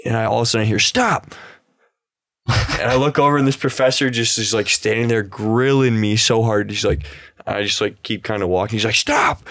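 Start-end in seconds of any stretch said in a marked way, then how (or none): 0.8-1.3 sharp high yell of a strict professor, mock; mock professor shout. Professor yelling at a late student, mock shout. 9.0-9.4 sharp high yell of a strict professor, mock; mock professor shout. Professor yelling at a late student, mock shout.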